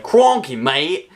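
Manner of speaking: Australian accent. Shown as a demonstration. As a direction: launch into an exaggerated Aussie accent. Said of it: "get into the Australian accent".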